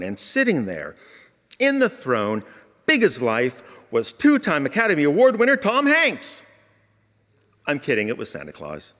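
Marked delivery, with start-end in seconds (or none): 3.9-6.4 high, loud showman announcement; mock grand reveal. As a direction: announce it like a game show host.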